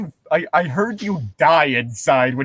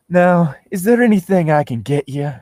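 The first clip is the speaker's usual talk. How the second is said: louder, higher Southern peach voice; sexy Southern accent; 5 semitones higher, 4 dB louder than usual. Acted. Nurse offering the patient help, sexy Southern accent. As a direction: put on a bright-pitched Georgia nurse accent. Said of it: "the accent of a sexy southern peach".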